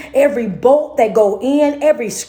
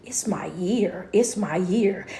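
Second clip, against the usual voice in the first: quiet, slow declaration; 5 semitones lower, 8 dB quieter, slower than usual. Real, not acted.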